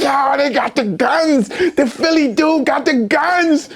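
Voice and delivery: high, slow mock cry of alarm; kids going crazy. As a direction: imitate kids freaking out, stretching the words. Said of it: "Them kids went crazy".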